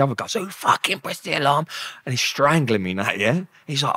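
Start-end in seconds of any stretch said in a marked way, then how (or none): 0.4-1.7 raised, heated demand; retold furious demand. Officer demanding who pressed the alarm, raised angry voice. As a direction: demand it like a guard who's lost it.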